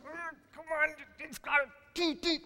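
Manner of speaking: high strained effort noises; mock straining at a corroded bolt; straining grunts. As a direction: push like cranking a stuck bolt. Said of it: "I'm just".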